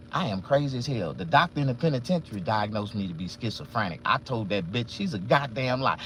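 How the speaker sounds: ordinary delivery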